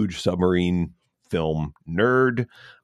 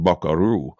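mock Russian accent. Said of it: "in Russian".

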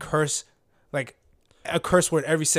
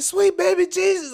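high, loud, drawn-out cry to heaven; hammed-up funeral lament; imitated loud wailing; 7 dB louder than usual. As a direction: mimic the mourner crying out on her knees. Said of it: "But she was loud".

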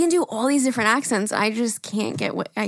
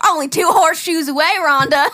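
loud, high country twang, near laughing; country accent; 6 semitones higher, 6 dB louder than usual. Retold or imitated. Naming each other on the drive, country accent. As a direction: call it out like a hammed-up country gal. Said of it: "busting out a country accent".

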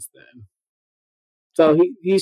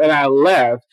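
ordinary talk

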